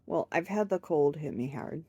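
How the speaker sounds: usual speaking voice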